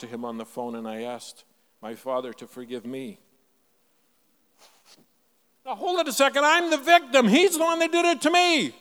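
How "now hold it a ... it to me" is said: high, loud mock outrage at being wronged; indignant victim. Preacher plays his old self insisting he's the victim, indignant and loud. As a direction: protest like the wronged one, pitched up and loud.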